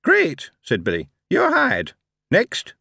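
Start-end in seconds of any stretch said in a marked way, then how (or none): 0.0-0.5 put-on high chirpy young clerk; bright, quick recruiting officer; high eager Billy voice. Billy speaks. 1.3-2.7 put-on high chirpy young clerk; bright, quick recruiting officer; high eager Billy voice. Billy speaks.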